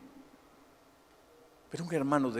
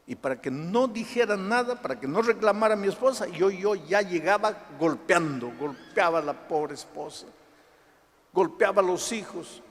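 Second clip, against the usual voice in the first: raised, driving pulpit retelling; impassioned testimony preaching; 8 semitones higher, 5 dB louder than usual. Retold or imitated. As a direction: build to a high, loud demand. Preaches violent homecoming at full force.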